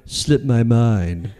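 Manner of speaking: low, very slow put-on excuse; King of Empty Promises. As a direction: drag out the excuse in a low character voice. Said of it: "King of Empty Promises".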